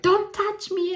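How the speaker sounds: put-on high, whiny little-girl protest; upset child. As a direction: mimic the crying kid pushing you away, pitched high. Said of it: "She's like".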